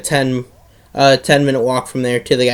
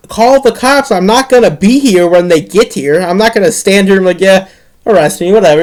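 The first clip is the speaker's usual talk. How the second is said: high, loud, goading retort; defiant comeback; 4 semitones higher, 5 dB louder than usual. Retold or imitated. Kid dares neighbor to call police, loud defiance. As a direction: dare him to go through with it.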